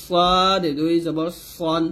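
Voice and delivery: সদ and সন with a thick, rounded s, drawn out; full-mouthed emphatic s on সন; heavy sad. Shown as a demonstration. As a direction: slowly round সদ toward an o. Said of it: "সদ মোটা করতে হবে".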